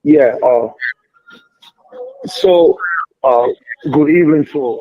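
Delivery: loud greeting down the line; shouting into a phone. Real, not acted. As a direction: speak up on a bad line. Caller on bad phone line.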